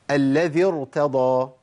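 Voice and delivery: "الذي ارتضى" recited very slowly, heavy ر. Shown as a demonstration. Teacher quotes a student's doubtful example, "الذي ارتضى" recited with heavy ر. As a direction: stretch "الذي ارتضى", keeping the ر thick. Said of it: "تفخم على طول".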